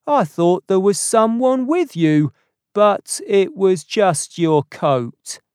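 high, loud explanation called across; apologetic ticket man. A narrator's reading.

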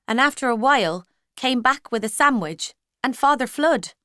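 plain reading voice

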